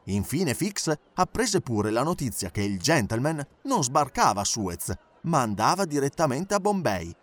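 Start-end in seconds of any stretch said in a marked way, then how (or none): none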